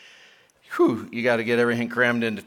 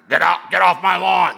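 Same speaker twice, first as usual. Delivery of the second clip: mock crotchety yell, higher pitched; grumpy get-off-my-lawn guy; 4 semitones higher, 4 dB louder than usual. Preacher mocking the guy who wants to be left alone, grumpy yell. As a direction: put on a cranky lawn-guarding grouch. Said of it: "you know, that type of guy".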